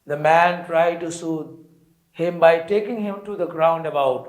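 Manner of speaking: slow, deliberate read-aloud for a class; slow story reading. Acted. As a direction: read each English phrase very slowly and clearly. Teacher reads story text aloud. (धीमी गति से कहानी पढ़ना)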